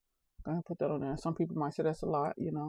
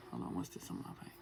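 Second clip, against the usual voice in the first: whispering; 14 dB quieter, faster than usual. Acted. Aside to self.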